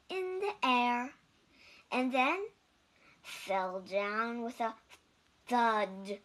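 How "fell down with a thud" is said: usual speaking voice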